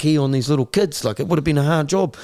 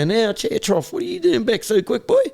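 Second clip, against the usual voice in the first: higher teasing guard voice scolding a repeat offender; mocking prison guard; 9 semitones higher than usual. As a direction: tease him like a guard who knows him by name. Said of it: "They go".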